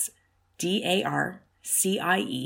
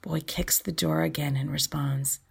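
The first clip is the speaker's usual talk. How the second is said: plain reading voice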